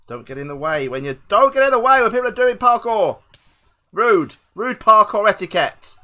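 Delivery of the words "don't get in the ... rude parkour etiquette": high, loud telling-off; loud, outraged scolding; indignant shout. Real, not acted. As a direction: yell at the player in your way. Scolding another player who blocked them.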